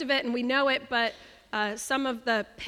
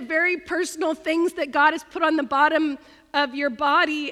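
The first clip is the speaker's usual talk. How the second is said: usual speaking voice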